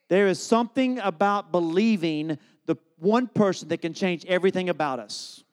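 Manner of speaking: ordinary talk, nothing marked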